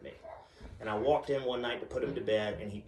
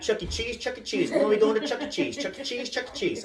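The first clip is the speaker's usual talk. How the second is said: put-on high, relentless kid chant; excited little daughter; 7 semitones higher than usual. Daughter begs dad all day, excited child voice. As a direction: imitate a pestering child in a high voice. Daughter pesters after her nap.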